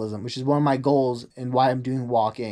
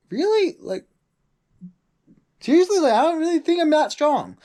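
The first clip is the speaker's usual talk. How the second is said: high-pitched incredulous reply; mock disbelief; 12 semitones higher than usual. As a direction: pitch leaps up in exaggerated disbelief. Retold disbelief at being praised.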